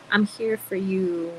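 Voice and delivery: slow, put-on consoling voice; mock tender comfort. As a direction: drag it out like a fake sympathizer. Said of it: "and be like".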